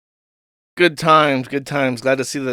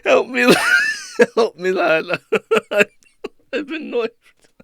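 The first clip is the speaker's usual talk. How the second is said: mock London plea; 9 semitones higher than usual. The caller begging again.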